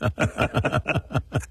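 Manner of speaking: a run of quick laughs. Real, not acted. Host and guest share amusement over the accent detail, laughing.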